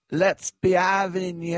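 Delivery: high, drawn-out crowd-rousing call; rallying a crowd. Retold or imitated. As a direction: imitate her calling out slowly to the stadium. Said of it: "and she said".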